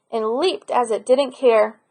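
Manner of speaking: ordinary delivery, nothing marked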